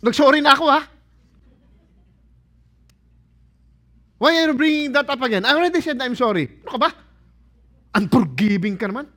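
loud, high, fast quarrelling complaint; an annoyed husband or wife snapping back in a quarrel; exasperated spouse. Pretend. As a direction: hurl it at your spouse mid-fight.

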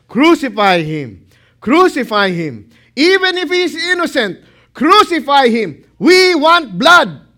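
loud, high frenzied mob chant; mob shouting. Acted. The mob roaring at Pilate for blood, loud and high. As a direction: scream it like a bloodthirsty crowd. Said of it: "what are they shouting?".

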